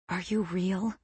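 soft frightened whisper. A narrator's reading. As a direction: breathe the question out. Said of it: "I whisper".

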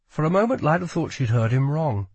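usual speaking voice

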